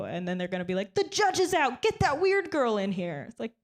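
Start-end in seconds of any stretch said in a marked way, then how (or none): 0.9-3.3 high, urgent mock courtroom call; mock lawyer. Comic imagining lawyers summoning her to court, pitched up.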